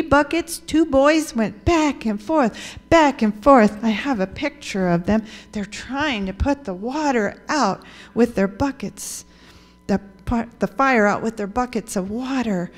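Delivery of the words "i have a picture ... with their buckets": ordinary delivery, nothing marked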